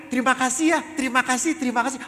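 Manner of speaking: high, loud cries of thanks; imitated child's shout. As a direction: call out thanks like an eager kid. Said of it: "dia selalu teriak".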